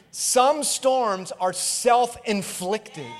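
usual speaking voice

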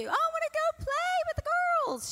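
put-on high-pitched little-girl pleading; toddler Bella. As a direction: imitate an eager two-year-old begging to play. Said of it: "what does she wanna do?".

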